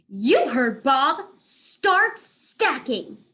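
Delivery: slow, high, stretched order; drawn-out cat command. Acted. The cat ordering the animals to start stacking. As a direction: drag the words out bossily.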